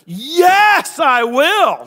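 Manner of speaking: loud, high, fired-up answer; emphatic yes. Acted. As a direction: answer eagerly, full of joy. Holy Spirit's answer voiced.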